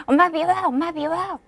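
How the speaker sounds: put-on high pouting child voice; sulking child. Upset child tells mom she hates her, high pouty voice. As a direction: pout and push mom away.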